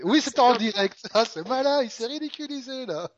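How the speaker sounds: teasing squeaky voice; mock him in a high pitch. Mocking partner in high voice.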